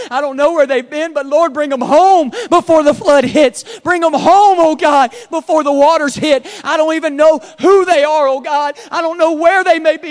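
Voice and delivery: desperate shouted prayer for the lost; pastor's fervent prayer. Retold or imitated. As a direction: cry out to God at full volume.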